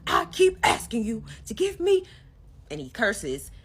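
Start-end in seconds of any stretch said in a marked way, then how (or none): 0.1-2.1 high, loud imitated yelling; retold shout, much higher and louder; mock yell. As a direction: shout it like a man losing it. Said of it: "then he starts yelling".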